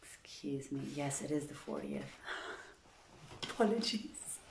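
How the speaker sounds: soft unvoiced apology; whispered aside. Real, not acted. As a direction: murmur it away from the mic. Reader apologizes again mid-reading.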